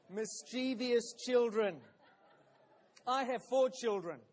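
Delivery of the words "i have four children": usual speaking voice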